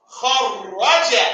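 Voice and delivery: kharaja drawn out, doubled r held; slow repeat. Shown as a demonstration. Teacher repeats the word slowly for the class. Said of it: "Everybody say it with me".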